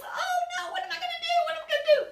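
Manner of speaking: very high mock panic, laughing; panicked pig. Little pig panicking after house falls, high. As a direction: squeal it in playful panic. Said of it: "the little pig's".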